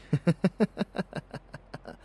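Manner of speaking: high, rapid wicked giggle; gleeful cackle. Acted. Villain teasing lover getting dressed, high gleeful cackle. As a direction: cackle in naughty delight.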